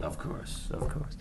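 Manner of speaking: whispered, conspiratorial of course; mock whisper. Host whispering back at a guest who can't speak about it. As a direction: hush it like a shared secret.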